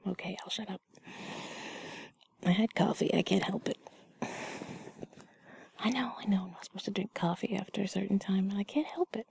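sheepish bedtime whisper. Acted. Held whisper.

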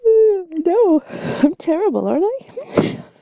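loud high yawned words; yawning. Shown as a demonstration. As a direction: confess playfully through a big yawn. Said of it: "I bet I'm making you yawn".

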